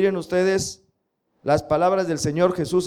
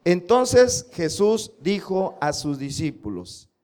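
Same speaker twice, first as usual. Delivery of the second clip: slow, word-by-word memory verse reading; slow, measured scripture recitation; slower than usual. Shown as a demonstration. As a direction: read it slowly so they can repeat. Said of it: "vamos a leer lo que dice Mateo".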